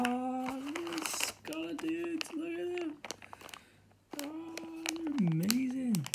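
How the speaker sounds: high, slow, squealing delight over the figures; delighted gushing. Real, not acted. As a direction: coo over them like they're adorable. Gushing over cute miniatures.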